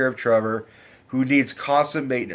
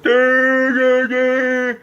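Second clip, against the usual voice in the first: loud, high-pitched crude caricature; drawn-out high monotone mock voice; 13 semitones higher, 7 dB louder, much slower than usual. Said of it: "he's doing the very stereotypical".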